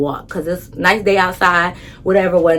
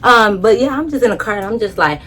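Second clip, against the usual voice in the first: normal talking voice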